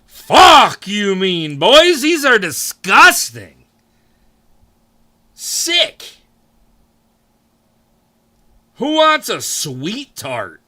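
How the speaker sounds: cartoonish disgusted taste buds, high and slow. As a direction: play your taste buds sneering at the candy. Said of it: "my taste buds are like".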